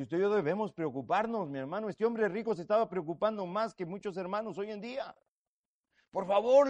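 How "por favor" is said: loud, high plea; loud, high begging of the rich man. Acted. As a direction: cry out please. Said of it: "le dice".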